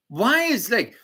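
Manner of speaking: normal talking voice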